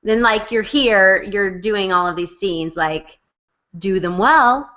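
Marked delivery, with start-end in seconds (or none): none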